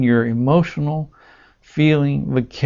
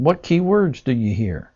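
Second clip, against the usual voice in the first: normal talking voice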